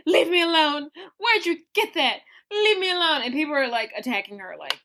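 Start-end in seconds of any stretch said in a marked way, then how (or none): 0.1-3.2 imitating a distressed girl. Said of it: "and she's like".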